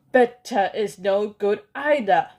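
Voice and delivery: loud shrill-sounding retort; shriek. Acted. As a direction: shriek it down at them. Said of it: "shrieked the Grand High Witch".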